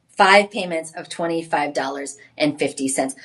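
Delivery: ordinary talk, nothing marked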